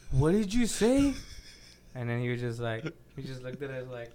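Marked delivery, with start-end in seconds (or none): 0.1-1.1 higher, louder, drawn-out disbelief; mock shocked teacher. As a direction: imitate a scandalized teacher demanding an answer. Acting out the shocked teacher's reply.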